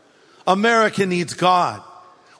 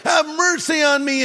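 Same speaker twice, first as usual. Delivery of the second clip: higher, louder pleading cry; loud plea; 8 semitones higher than usual. Retold or imitated. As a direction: call out desperately for mercy. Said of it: "really loudly".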